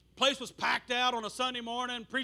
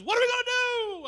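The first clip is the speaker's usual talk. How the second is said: much higher, louder frantic question; panicked plea; 13 semitones higher, 7 dB louder than usual. Retold or imitated. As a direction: beg for help in a panic. Pastor panics after being hit.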